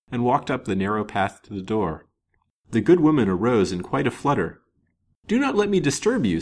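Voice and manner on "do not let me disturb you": a polite, higher gentleman's reassurance; mildly courteous stranger. A narrator's reading. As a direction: put her at ease, courteously. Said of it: "said he courteously".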